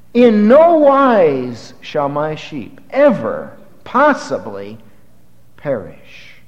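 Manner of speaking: slow, weighted "In no wise shall my sheep ever possibly perish"; emphatic slow reading. Shown as a demonstration. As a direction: stretch "In no wise" out for full force. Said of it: "That's the impact of what Jesus said".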